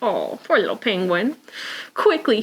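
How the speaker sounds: ordinary delivery, nothing marked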